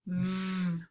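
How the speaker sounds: V as a long, level buzzing vvv; isolated final sound. Shown as a demonstration. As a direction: hold the humming friction of V, not the letter name. Final-sound drill, isolated phoneme.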